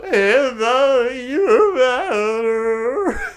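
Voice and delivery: slow wailing mock lament; sad drunk voice. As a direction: drag it out like a weepy drunk. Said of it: "You sounded like a drunk old sad guy at a bar".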